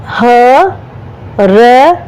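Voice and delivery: ordinary delivery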